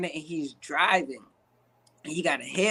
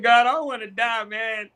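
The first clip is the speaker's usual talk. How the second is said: mock pleading fear; 3 semitones higher than usual. Acting out fear of approaching man.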